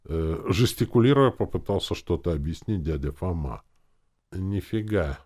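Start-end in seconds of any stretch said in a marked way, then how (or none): none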